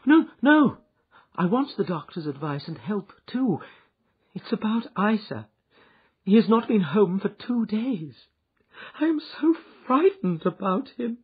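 high, loud, panicky pleading; frightened woman. Acted. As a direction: plead in fear for her husband. Frightened wife pleads for help.

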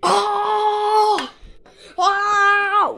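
high startled "Oh!" cry; shocked cry. Pretend. Toy figure shocked, crying out.

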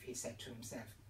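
ordinary delivery, nothing marked

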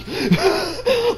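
high, amused laugh bursting out. Real, not acted. Viewer laughing at how Japan pictures Americans, high laugh. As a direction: laugh at the absurdity.

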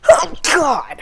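sudden loud cry after a sneeze; startled outburst. Real, not acted. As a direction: blurt it out, caught off guard. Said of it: "I am just sneezing all over the place".

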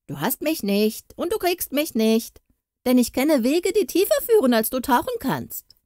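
high-pitched taunt; mocking. A narrator's reading. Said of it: "spottete der Heilbutt".

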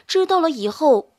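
ordinary delivery

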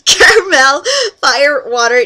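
normal talking voice

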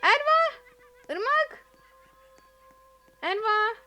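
high, loud repeated calls; frantic calling. Real, not acted. Player calling a friend she just spotted, loud high yells. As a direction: keep yelling to get her attention.

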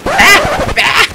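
very high loud yelp; high scream. Real, not acted. Gamer screaming as he gets killed. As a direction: shriek in sudden panic.

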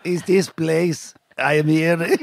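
slow, deliberate mock Nigerian accent; comic Nigerian accent, spacing out the words. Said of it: "let [name] do his Nigerian accent".

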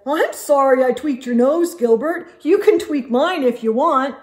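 put-on boy apologizing to his friend; apologetic boy. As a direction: offer your nose as a truce.

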